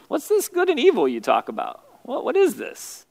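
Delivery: high, baffled questioning; puzzled curiosity. Acted. Adam and Eve pondering the serpent's words, puzzled. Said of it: "Adam and Eve are thinking".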